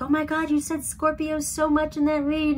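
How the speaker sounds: high-pitched mock outcry; imitating astonished viewers. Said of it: "I was so shocked when everybody was like".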